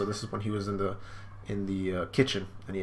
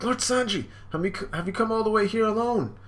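much higher, startled nurse voice; surprised nurse; 12 semitones higher, 4 dB louder than usual. Retold or imitated. The nurse is startled to see the prince, high and surprised. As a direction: gasp it out like a shocked nurse. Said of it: "It's like".

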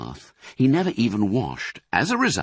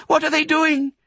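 very high little fox voice; high-pitched, loud, panicky child's cry; alarmed cry; 5 dB louder than usual. A narrator's reading. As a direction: cry out like a frightened small cub. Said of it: "cried the small foxes".

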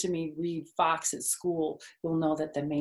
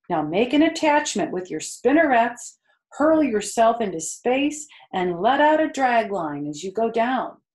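louder, higher instructions; commanding spider; 5 semitones higher, 8 dB louder than usual. Acted. Charlotte coaching Wilbur from her web, louder. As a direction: call out the steps like a coach. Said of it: "said Charlotte".

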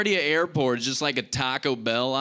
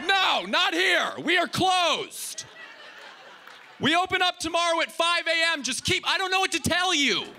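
put-on yelling kid on runway. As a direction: wave off a landing plane, yelling. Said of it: "yelling at planes like".

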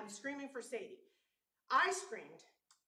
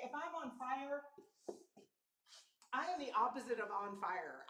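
ordinary delivery

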